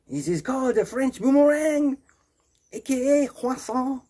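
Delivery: high, hammed-up cartoon voice; French character. French character showing off a boomerang croissant, high comic voice. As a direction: present it in a silly high French voice.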